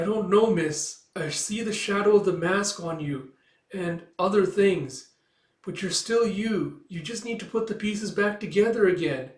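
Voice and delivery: high, slow, gentle-hearted youngster; earnest little fox. Acted. Little fox telling Micah what they see, earnest and high.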